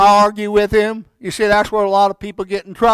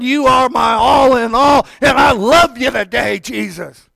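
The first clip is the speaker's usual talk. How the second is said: fervent shout to God; 3 semitones higher, 4 dB louder than usual. Shown as a demonstration. Preacher shows the morning declaration, shouting to God. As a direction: declare it out loud like a morning prayer. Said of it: "you look up and you say".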